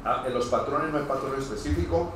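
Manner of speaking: ordinary talk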